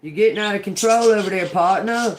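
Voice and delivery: exasperated call across the room; loud fast scolding. Real, not acted. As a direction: rein someone in, loud and quick. Scolding something out of control.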